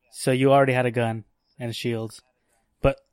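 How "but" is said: coughing on a swallowed bug; sudden cough that chokes off the word; just swallowed a bug. Real, not acted. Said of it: "Dude, I just swallowed a bug".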